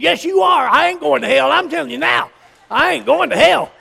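high, loud, insistent retort; heated argument. Retold or imitated. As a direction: act out a stubborn marital row. Acted quarrel of husband and wife.

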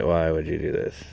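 usual speaking voice

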